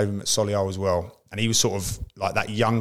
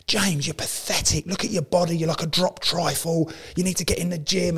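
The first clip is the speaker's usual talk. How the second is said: imitating a screaming teammate; 8 semitones higher than usual. Teammate Gaz berating James about his body, mock higher-pitched yell. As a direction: play the gym-obsessed teammate scolding a lazy striker, higher and slowed. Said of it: "screaming at him".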